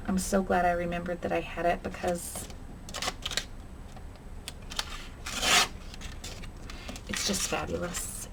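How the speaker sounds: softly fading into a whisper; whispered delight. Real, not acted. Crafter whispering her delight over the paper, hushed. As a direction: hush it, it's just fabulous.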